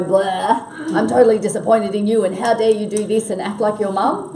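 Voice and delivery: loud, fast telling-off; mock scolding. Shown as a demonstration. Boss scolding a staff member who let her down, loud and fast. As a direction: rant at him like a disappointed parent. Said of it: "I don't need to go".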